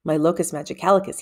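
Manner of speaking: usual speaking voice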